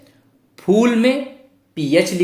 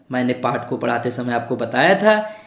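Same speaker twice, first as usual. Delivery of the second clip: ordinary talk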